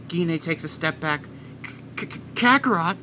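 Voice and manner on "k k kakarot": stammering shock. Acted. Gine shocked by son.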